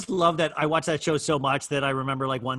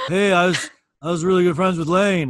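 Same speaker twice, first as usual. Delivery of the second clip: a mild put-on eager voice, louder; the drummer boasts to strangers, mildly mimicked; imitating the drummer; 3 semitones higher, 6 dB louder than usual. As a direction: brag about knowing Lane. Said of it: "He's like".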